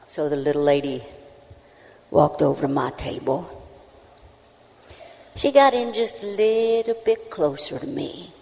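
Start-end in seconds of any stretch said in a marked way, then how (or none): none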